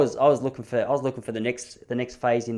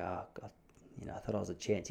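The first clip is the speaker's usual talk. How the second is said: deflated; 6 semitones lower, 17 dB quieter than usual. Retold or imitated. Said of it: "And I go".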